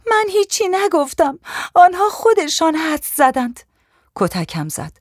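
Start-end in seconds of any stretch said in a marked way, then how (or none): 0.1-3.6 very high, distressed denial; frightened pleading. A narrator's reading. Young woman thrown down, denying she told anyone, frightened high plea. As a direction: plead innocence in a high, desperate voice. Beaten victim denies the accusation.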